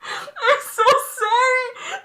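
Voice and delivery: high, slow, laughing sorry; squeaky drawn-out laughing sorry; laughing apology. Real, not acted. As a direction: giggle through the apology.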